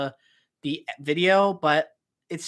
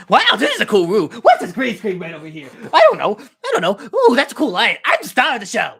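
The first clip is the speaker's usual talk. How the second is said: fast, loud, excitable Donkey voice; Donkey impression; 5 semitones higher, 10 dB louder than usual. Streamer doing Donkey for chat, loud and giddy. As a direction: bounce off the walls like an excited donkey sidekick. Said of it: "That's my impression of Donkey".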